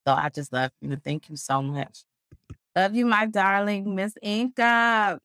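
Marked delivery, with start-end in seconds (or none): 2.7-5.2 drawn-out, high-pitched adoring greeting; sing-song lilt of real fondness; gushing affection. Real, not acted. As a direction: gush lovingly, stretching the words out. Gushing love to a friend.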